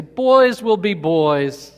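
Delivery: loud, slow mock parent shrugging it off; hammed-up parent. Parent excusing misbehaving kids, slow loud mock drawl. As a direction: drag it out like an indulgent parent. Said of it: "they see their kids acting up and they say".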